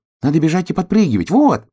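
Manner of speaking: high, fast, loud hedgehog; eager, high-pitched little creature; excited hedgehog. Acted. Excited hedgehog demonstrating his way.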